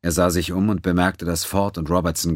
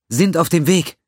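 urgent shout; 12 semitones higher, 6 dB louder than usual. Acted. Doctor answers emergency call.

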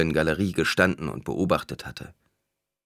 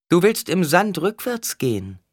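high, projected answer; oracle's proclamation; 11 semitones higher, 5 dB louder than usual. A narrator's reading. Alia answers the pleading mother, proclaiming loudly. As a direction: pronounce it over the crowd, loudly.

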